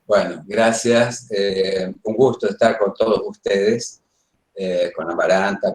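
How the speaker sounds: normal talking voice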